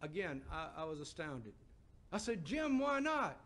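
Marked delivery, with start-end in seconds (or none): 2.4-3.4 higher and louder, stunned why not; pleading in disbelief, voice jumping up; astonished plea. Retold or imitated. Preacher to Jim after his refusal, astonished and louder.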